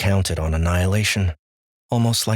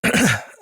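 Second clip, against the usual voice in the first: a short nervous throat clear; 4 semitones higher than usual. Acted. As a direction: clear the throat before speaking. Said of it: "Austin cleared his throat".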